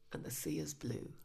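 soft unvoiced whisper. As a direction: breathe it out in a whisper. Whispered aside.

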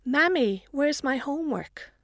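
put-on high little girl's question; a small girl asking anxiously; child voice. Little daughter calls out.

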